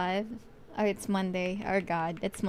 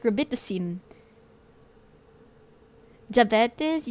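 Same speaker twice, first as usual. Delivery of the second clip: ordinary talk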